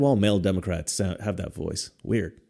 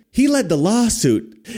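much higher, slow whiny politician; mock Democrat voice; 10 semitones higher, 3 dB louder than usual. Vlogger mocking Kinzinger's tweet in a higher whine. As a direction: stretch it out like a nasal congressman. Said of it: "They've all got the same accent".

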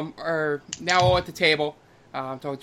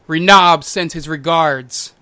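louder, slow and ominous; menacing call from above; 9 dB louder, slower than usual. Acted. Hidden dark elf on the ceiling, loud and slow. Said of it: "coming from the ceiling is".

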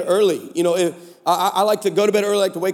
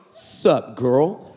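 very low, slow-rolling come-on; Antoine's flirty voice; 10 semitones lower than usual. Retold or imitated. Texter flirting with supposed Darnisha, very low. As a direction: drop it deep for a flirty greeting. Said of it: "To which Antoine hit back".

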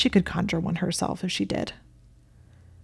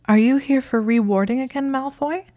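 high-pitched, baffled asking; confused, higher-pitched query; baffled question; 7 semitones higher, 3 dB louder than usual. A narrator's reading. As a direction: ask it in betrayed bafflement, pitch climbing to the end. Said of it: "feeling betrayed and baffled".

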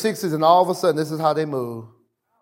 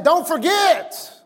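emphatic command; 8 semitones higher, much slower than usual. Acted. Quoting the Lord's command emphatically.

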